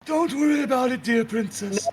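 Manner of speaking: high, loud faux comfort; sinister captor reassuring the princess; mock soothing Creeper. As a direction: soothe her falsely.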